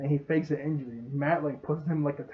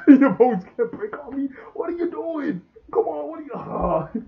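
high, exaggerated yell of protest; mock yelling; 7 semitones higher, 6 dB louder than usual. Jim yelling at injured Orc to come on, mock-desperate. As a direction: holler in hammed-up disbelief, voice climbing higher. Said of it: "he's yelling at him like".

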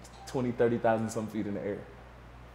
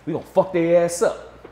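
higher, louder rowdy threat; fired-up mock threat; 6 semitones higher, 5 dB louder than usual. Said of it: "and [name] like".

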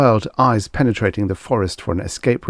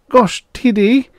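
high, slow, louder protest; disapproving; 6 dB louder than usual. A narrator's reading. Assistant frowns at his boss, disapproving. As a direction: object to it, drawn out and pitched up. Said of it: "Frick frowned disapprovingly".

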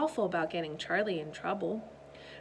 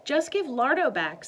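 high, firm demand; 7 semitones higher, 7 dB louder than usual. Acted. Alice demanding the cat.

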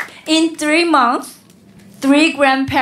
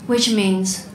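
ordinary talk

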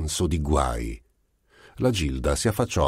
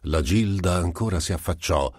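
ordinary delivery

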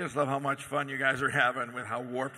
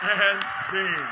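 announcing loudly; 2 semitones higher, 4 dB louder than usual. Real, not acted. Host ends the scene.

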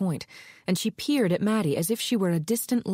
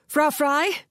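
high, loud call through the door; shouting; 9 semitones higher, 4 dB louder than usual. Acted. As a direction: shout her name, higher and louder. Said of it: "pounded on Ilona Fry's door and shouted".

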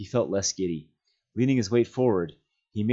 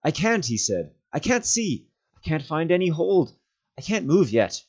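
higher, strained plea in the dark; Frodo frightened; 9 semitones higher, 3 dB louder than usual. Acted. Frodo calling up to Sam from the cliff, frightened. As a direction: voice him stuck and scared on the cliff.

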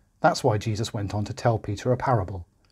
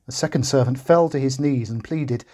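ordinary delivery, nothing marked

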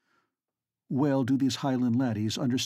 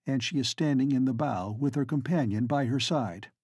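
usual speaking voice

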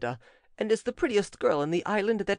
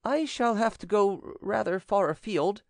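nervous evasion; 8 semitones higher than usual. A narrator's reading. Evasive servant stalls, stumbling on words.

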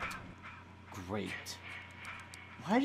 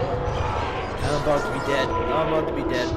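loud, repeated cry of doom; alarmed shouting; 3 semitones lower, 7 dB louder than usual. Real, not acted. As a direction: panic loudly as the game turns on you. Player about to die in game.

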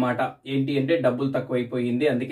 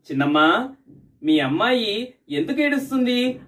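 put-on concerned child asking; 7 semitones higher than usual. Boy asks mother.

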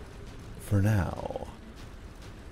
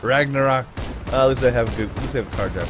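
usual speaking voice

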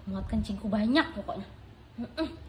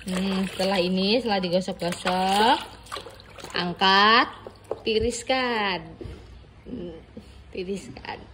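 cooking show host; 5 dB louder than usual. Acted. Recipe-style "tiriskan.

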